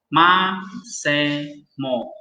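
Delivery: "Ma se mo" read very slowly, syllable by syllable. Shown as a demonstration. Teacher sounding out Hebrew words slowly for students. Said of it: "Jadi, apa".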